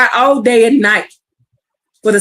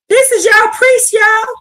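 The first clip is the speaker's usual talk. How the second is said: much higher, exclaiming "This is your priest"; exclaiming preacher; 9 semitones higher than usual. Real, not acted. Preacher denouncing the priests to the congregation, high exclaiming. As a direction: call it out in disbelief, pitch way up.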